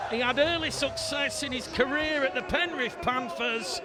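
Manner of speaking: loud, high-pitched live rugby commentary; excited try call. Real, not acted. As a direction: belt out the try as it happens, voice lifted high.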